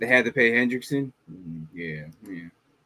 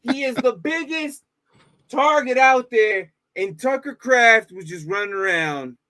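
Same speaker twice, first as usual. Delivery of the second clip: exasperated rant; 8 semitones higher, slower than usual. Real, not acted. Venting at the Commanders' defense.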